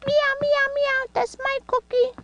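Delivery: high meowing cat voice; kitty voice. Pretend. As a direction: squeak it like a playful kitten. Voicing the cat toy.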